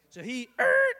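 suddenly high and loud; a jolt of alarm; startled. Retold or imitated. Brother reacts startled.

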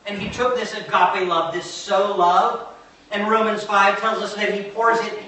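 insistent preacher, driving home each key word; impassioned preaching. Real, not acted. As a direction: preach it with mounting conviction.

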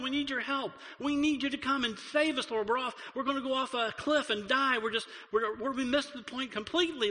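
high, urgent pleading with God; frantic prayer for rescue. Retold or imitated. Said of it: "You go".

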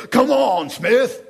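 loud, high coach bark; mock coach shout at a player; retold coach hollering at a player in his face; coach yelling.

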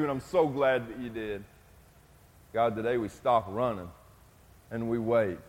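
soft, hushed prayer voice. Acted. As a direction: pray humbly for the church. Leads congregation in prayer.

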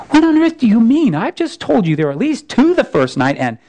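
put-on a startled boy blurting it out; astonished Shasta.

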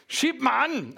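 "Sheep man" said high, with a put-on twang; imitating the author's "sheep man". Pastor imitates how the author says "Sheep man" with relish. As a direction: mimic the old farmer saying "Sheep man". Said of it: "I like how he says that".

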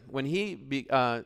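usual speaking voice

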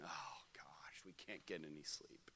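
low whispered exasperation; mock exhausted whisper in the night; weary parent groan. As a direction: sigh it out, worn out. Retold weary parent hearing crying.

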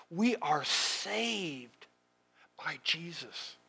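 very slow, soft declaration, drawing out "saved"; hushed, drawn-out "We are saved", fading on "by Jesus"; hushed emphasis. Shown as a demonstration. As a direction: let it sink in quietly, word by word. Hushed contrast to the shouted line.

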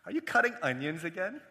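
teasing, rising question after a chuckle; amused, light check-in; joking question. Retold or imitated. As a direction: ask it as a running joke.

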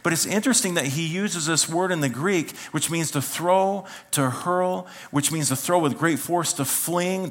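ordinary talk, nothing marked

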